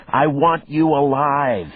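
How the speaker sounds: high, loud, slowed-down shout; yelling. A narrator's reading. Detective yelling at the killer to stay alive. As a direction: insist over the struggle. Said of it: "I yelled back".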